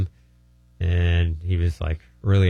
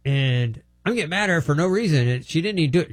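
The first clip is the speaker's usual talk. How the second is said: higher, lightly voiced confession; a hint of the startled pastor; 7 semitones higher than usual. Pastor explaining the grudge against his wife, lightly higher. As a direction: confess in surprised realisation. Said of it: "He says".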